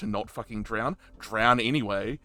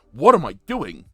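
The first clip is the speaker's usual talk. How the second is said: louder, panicked self-questioning; Shaw panicking; 6 semitones higher, 9 dB louder than usual. Retold or imitated. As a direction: snap out of it in alarm. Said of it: "the survival instinct kicks in of Shaw going".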